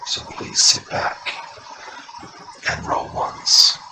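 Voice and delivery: booming echoing villain monologue; a gloating undead lord welcoming doomed guests; Lich Lord voice. Acted. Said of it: "The Lich Lord?".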